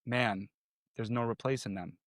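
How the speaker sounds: soft grieving comfort; tender comfort. Retold or imitated. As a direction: hold them close with your voice. Hugging someone grieving.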